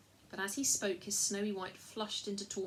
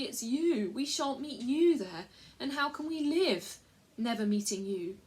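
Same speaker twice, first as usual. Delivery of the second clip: sobbing plea; 4 semitones higher, 7 dB louder than usual. Acted. Lucy sobs to Aslan that she can't live without him. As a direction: plead through tears, heartbroken. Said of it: "sobbed Lucy".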